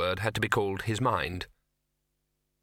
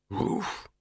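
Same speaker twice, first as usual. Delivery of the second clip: breathy little woof; a sympathetic doggy woof; 8 semitones higher than usual. Acted.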